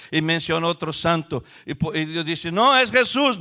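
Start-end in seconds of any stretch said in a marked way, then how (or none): 2.5-3.4 loud, high insistent correction; God's firm correction. Acted. God correcting the people, loud insistence. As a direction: cut them off firmly, as God.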